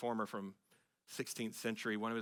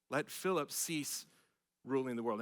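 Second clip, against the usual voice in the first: slow, deliberate. Shown as a demonstration. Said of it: "slow repeat of the quote".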